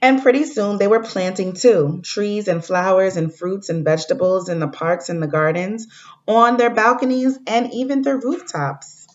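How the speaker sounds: storybook read-aloud to the class; reading to children. Acted. Teacher reading a story to fourth graders, gentle read-aloud. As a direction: read it like a picture book for kids.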